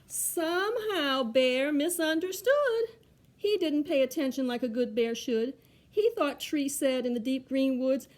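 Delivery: lively, projected picture-book reading; children's story narration. A narrator's reading. Reader tells children how Bear misunderstood, story narration. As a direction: read it out clearly with bouncy rhyme.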